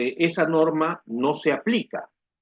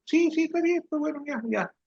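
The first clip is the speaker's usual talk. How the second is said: put-on much higher, breezy yes-yes of a kid; brushing off mom's order; dismissive kid; 14 semitones higher, 3 dB quieter than usual. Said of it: "son responds to mom". (niño que responde a la ligera a su mamá, con voz mucho más aguda)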